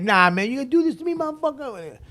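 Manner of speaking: high, fast mocking taunt; mocking rival. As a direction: jeer at him in a squeaky put-on voice. Rival MC mocking him on stage.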